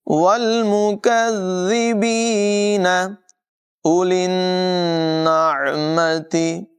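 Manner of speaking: slow chant with full मुकज़्ज़िबीन ending; Quran recitation. Shown as a demonstration. Teacher shows correct reading to students, slow chant. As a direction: recite मुकज़्ज़िबीन fully, never cut short. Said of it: "बल्कि".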